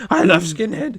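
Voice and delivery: the woman mimicked in an altered voice on the repeat; imitating her, repeated. Narrator mimics the older woman at the bar in a put-on voice. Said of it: "And she's like".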